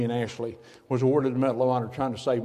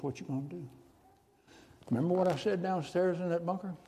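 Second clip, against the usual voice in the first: hushed divine voice; 3 semitones higher, 8 dB quieter than usual. Acted. A heavenly voice speaks.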